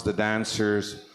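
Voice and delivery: ordinary delivery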